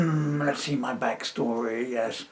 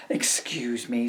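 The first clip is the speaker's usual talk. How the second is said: ordinary delivery, nothing marked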